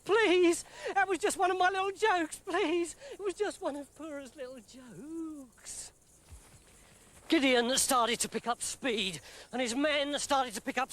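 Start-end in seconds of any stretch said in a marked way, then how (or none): 0.0-5.9 high, fast nervous backpedalling; nervous pleading servant. Acted. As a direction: squeak out a flustered apology.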